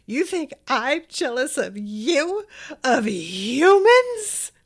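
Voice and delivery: incredulous mock yell, building to the end; indignant yell. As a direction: act out an affronted villain yelling, stretching the last word. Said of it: "yelling about how he has been humiliated".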